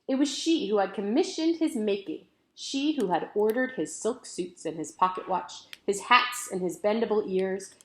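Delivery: plain reading voice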